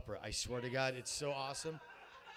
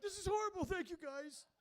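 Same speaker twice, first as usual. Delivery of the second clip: very high, squeaky put-on thanks; comic falsetto reaction; high mock-distressed voice. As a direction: pitch way up in exaggerated dismay. Acting out his reaction to guests.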